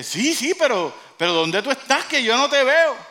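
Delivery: high, loud, puzzled calling; agitated calling out. Acted. Jack to the voice he cannot see, calling agitatedly. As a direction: shout up in acted bewilderment.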